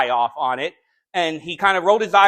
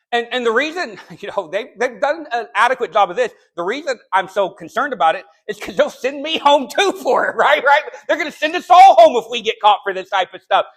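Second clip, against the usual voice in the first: normal talking voice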